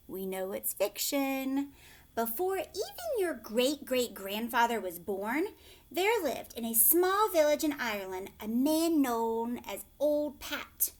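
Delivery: ordinary delivery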